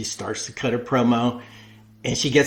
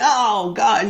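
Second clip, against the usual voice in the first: mock audience cringe; 10 semitones higher, 4 dB louder than usual. Audience reacting to silly question.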